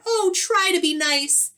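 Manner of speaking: high, loud frightened plea; whimpering lion. Acted. Frightened lion begging the mouse not to hurt him, high and loud. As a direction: beg like a scared big cat. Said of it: "he whimpered".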